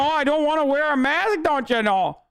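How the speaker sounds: hammed-up Minnesota accent complaining about the mask; mock Minnesota Karen. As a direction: parody the birthday Karen in a sing-song Minnesota accent. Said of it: "I'm Minnesota".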